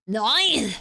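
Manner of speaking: loud one-word mock German bark; attempted German accent. Shown as a demonstration. Streamer failing at a German accent for chat, forceful mock German. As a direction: try a forceful German "Nein". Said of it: "can't even do a German accent".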